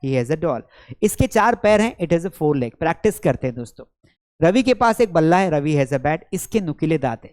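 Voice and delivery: usual speaking voice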